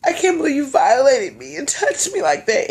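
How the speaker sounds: high, put-on wounded voice; fake tearful accusation. Accusing him of touching her, fake tearful. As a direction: play the violated victim, hammed-up.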